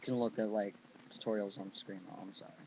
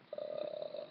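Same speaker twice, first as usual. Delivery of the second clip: low, rattling inhaled croak; inhaled Grudge croak; 5 dB quieter than usual. Shown as a demonstration. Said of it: "kinda like that".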